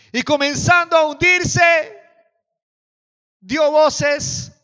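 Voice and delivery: high, loud preaching cry; dramatic shout. Acted. Preacher reads how Peter began to sink, shouted dramatically. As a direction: raise it high and loud as the sinking starts.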